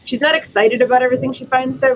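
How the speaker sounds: ordinary talk, nothing marked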